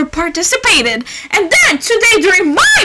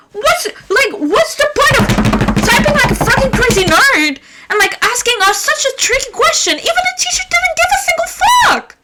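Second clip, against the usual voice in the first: yelling rant; 10 semitones higher than usual. Real, not acted. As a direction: rant at the top of your voice. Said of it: "I just yelled for the past five minutes".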